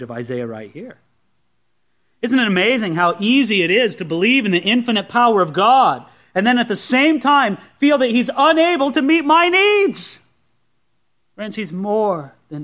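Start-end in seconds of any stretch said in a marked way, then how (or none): 2.2-10.2 emphatic preacher's delivery building to a high peak; impassioned preaching. Acted. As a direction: preach it with mounting incredulity. Preaches at full force.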